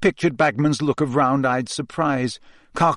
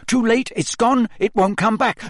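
panicked; 9 semitones higher than usual. Acted. Panicked reciting.

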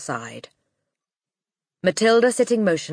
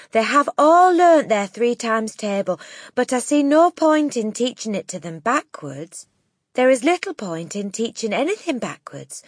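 higher, emphatic protest; Miss Honey; 7 semitones higher, 5 dB louder than usual. A narrator's reading. Teacher standing up to the headmistress, raised indignant voice. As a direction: argue it out indignantly, pitch lifted.

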